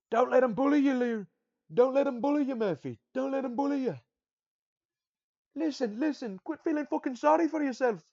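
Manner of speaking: loud, high Scottish-Irish corner coach yelling. Retold or imitated. Scottish-Irish coach shouting at his fighter between rounds. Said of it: "That's more Scottish or Irish".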